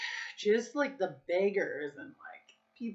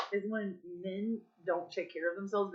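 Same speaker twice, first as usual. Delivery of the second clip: usual speaking voice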